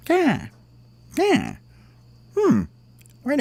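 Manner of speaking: short high squawks, falling sharply; crow cawing. Acted. Crow looks around from the stick, high squawks. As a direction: caw like a crow, with pauses between. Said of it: "and he went".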